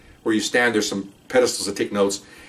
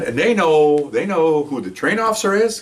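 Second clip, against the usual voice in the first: usual speaking voice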